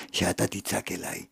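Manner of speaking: very low, soft voice of God; hushed divine answer. Acted. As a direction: drop right down, intimate and grave. God's intimate answer, voiced by preacher. (תשובה שקטה ונמוכה מאוד בשם האל)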